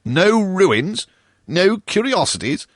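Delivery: high, loud incredulous repeat of no ruins, no curiosities; mock-outraged ghost echoing her; indignant echo. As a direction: fling her words back in disbelief. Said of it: "answered the ghost".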